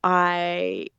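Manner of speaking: ordinary talk, nothing marked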